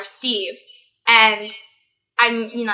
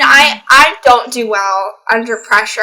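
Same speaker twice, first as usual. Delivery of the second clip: normal talking voice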